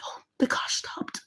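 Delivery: whispered, breath-held narration; hushed suspense. A narrator's reading. Storyteller watching the car stop near the woman, whispered suspense. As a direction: whisper it as the danger halts.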